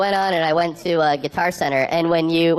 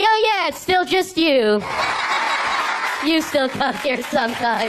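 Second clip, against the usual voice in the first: much higher, slow, laughing clerk; sheepish clerk voice; 9 semitones higher, slower than usual. Retold or imitated. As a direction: backpedal like an embarrassed salesman. Clerk awkwardly admits he meant him.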